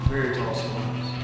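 ordinary talk, nothing marked

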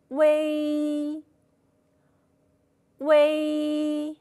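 微 held long and even, u gliding into ei; drawn-out pinyin ui. Shown as a demonstration. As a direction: model 微 slowly for the class to copy. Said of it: "听清老师的读音，跟着读一读".

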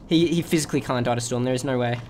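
ordinary talk

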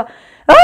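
loud drawn-out playful shriek; mock rollercoaster scream. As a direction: scream as if the coaster just dropped. 롤러코스터 떨어지는 비명 흉내. (롤러코스터에서 떨어지는 비명 흉내)

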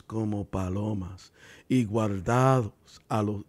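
slow, measured Bible reading; scripture reading. Acted. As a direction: read the verses with weight. Reads Mateo ten aloud.

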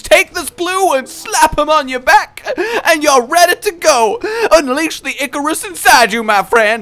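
high, loud hyped-up bro voice; pushy salesman pal handing out gifts; the game talking. Retold or imitated. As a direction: play the game as an overeager buddy. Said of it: "it's like the game was like".

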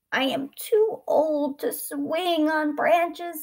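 put-on slow, high old-man voice. A narrator's reading. Old boy declining to swing, slow and aged. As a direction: stretch it out, high and weary. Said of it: "So skinny and old now".

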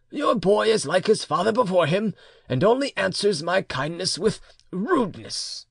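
complaining uncle. A narrator's reading. Uncle complains of the boy to his mother, reproachfully.

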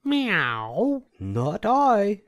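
high meowing cat voice; a cat mewing its refusal. A narrator's reading. Cat refusing to help the hen with a high meow. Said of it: "said the cat".

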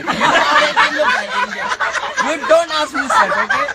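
high, loud, half-laughing telling-off; mock indignation. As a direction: shut down the objection in mock outrage. Comic teacher overrides pupil's objection.

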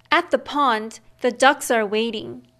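plain reading voice

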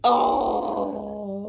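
hoarse, rough heavy-smoker voice; croaky like a heavy smoker; smoker's rasp. Real, not acted. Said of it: "You sound like a heavy smoker".

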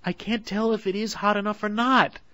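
whimpering. A narrator's reading.

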